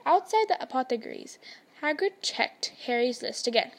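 usual speaking voice

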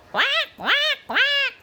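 very high duck quacking; duck quacks. Acted.

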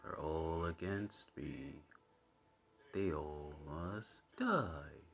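slow, hushed mock-menacing mutter; sinister imitation. Viewer echoing a cartoon's murderous thought, slow mock menace. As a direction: drag it out softly and darkly.

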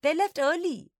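put-on high, loud child's squeak; squeaky young girl's announcement. A narrator's reading. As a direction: pipe up as a little sister. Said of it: "a familiar voice squeaked".

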